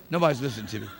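ordinary talk

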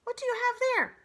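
high, loud, curious fox voice; pry like a nosy fox; Fink the fox. Acted. Fox eyeing the robot's hands, high and curious. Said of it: "said Fink".

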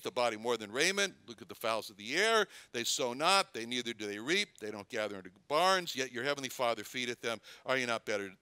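ordinary delivery

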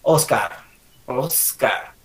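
low, careful "Oscar"; emphasised S. Shown as a demonstration. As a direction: hiss the S in OSS-car. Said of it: "la S".

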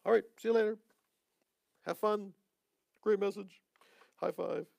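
high, upbeat mock send-off; mock breezy goodbye. Preacher mocking a breezy goodbye to the congregation. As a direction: toss it off like a careless cheerful farewell.

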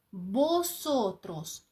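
"Босотрос" very slowly, opening on a b sound for the written V; slow teaching model of "Босотрос" with initial b; V read as B. Shown as a demonstration. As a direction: stretch out "Босотрос" syllable by syllable, starting with b. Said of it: "на початку слів читається як Б".

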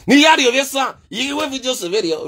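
high call, then dropping off; called name, then fading. Acted. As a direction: shout his name, then trail down. Calling out to Ovesan.

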